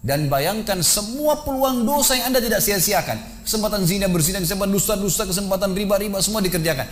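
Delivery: high, insistent preaching cry; urgent admonition. Retold or imitated. Preacher confronts a man playing corpse with his sins, urgent admonition.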